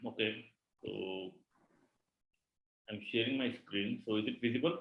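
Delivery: faint, far from the mic; barely audible. Real, not acted. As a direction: voice very low and hard to hear. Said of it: "sir, your voice is very low".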